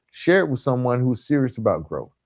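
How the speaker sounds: usual speaking voice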